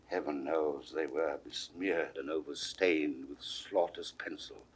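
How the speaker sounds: hushed dread. Acted. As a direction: let it sink to a whisper. King recalls bloodshed, hushed.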